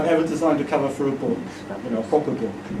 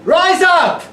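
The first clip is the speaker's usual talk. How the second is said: loud, high call to revolt; repeated full-voiced battle cry; rousing shout; 14 semitones higher, 7 dB louder, much slower than usual. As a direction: shout it to stir the crowd. Climax of the performed poem.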